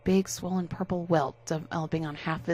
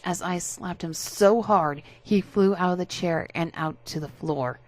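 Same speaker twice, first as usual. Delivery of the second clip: ordinary delivery, nothing marked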